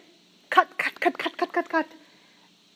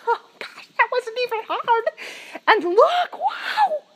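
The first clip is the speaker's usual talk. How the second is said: higher, rising into a joyful shout; excited relief; 8 semitones higher than usual. Retold or imitated. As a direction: burst out in delighted amazement. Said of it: "and we were like".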